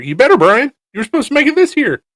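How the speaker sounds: loud, high call across the room; teasing friends across the room, loud and high. Real, not acted. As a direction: shout it over to a friend. Calling out to people passing.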